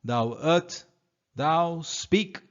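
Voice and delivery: loud summoning call, slow and drawn out on slave Caliban. Acted. Master calling his slave out of the cave, loud call. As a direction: bellow his name into the cave. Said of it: "Prospero calls out to him".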